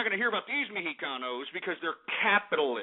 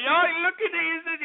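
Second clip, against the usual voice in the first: high, slurred mock Mexican accent; hammed-up, high-pitched Mexican accent; 8 semitones higher than usual. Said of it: "these dumbass Mexicans here saying".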